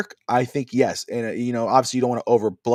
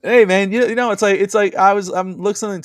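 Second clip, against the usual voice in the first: excited; 8 semitones higher, 7 dB louder than usual. Real, not acted. Laughing defence after being teased.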